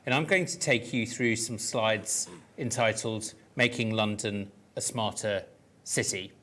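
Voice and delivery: usual speaking voice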